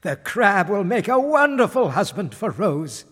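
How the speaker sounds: delighted king. A narrator's reading. King approves the crab as Rose's husband, delighted. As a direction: declare it with royal delight. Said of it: "said the king".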